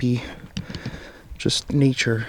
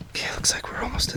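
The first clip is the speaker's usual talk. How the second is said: whisper; 11 dB quieter than usual. Real, not acted. Sneaking out of haunted woods.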